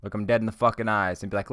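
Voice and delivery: usual speaking voice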